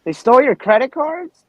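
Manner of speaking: high, loud disbelieving question; can't believe it, pitch up; surprised. Real, not acted. Shocked at stolen cards.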